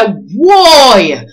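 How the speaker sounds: big, high, loud "Whoa!"; loud exclamation. Acted. Storytellers holding their hips during a story, a loud delighted exclamation. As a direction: cry out like a storyteller in full swing.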